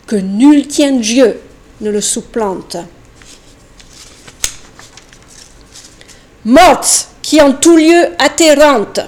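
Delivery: loud, solemn poetry recital; declaimed poem. Acted. Reciting an old ballade to an audience, declaimed. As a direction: proclaim each line to a hall.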